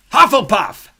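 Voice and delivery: loud, high drawn-out shout; hat shouting. A narrator's reading. The hat shouts its verdict to the hall. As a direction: announce the house with a booming cry.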